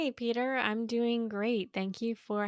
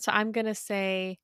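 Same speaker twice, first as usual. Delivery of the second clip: ordinary talk, nothing marked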